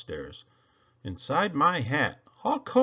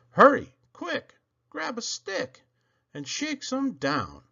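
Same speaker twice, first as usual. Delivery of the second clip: eager urging; 8 semitones higher than usual. Acted. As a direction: urge them on, all excited. Urging to shake fruit down.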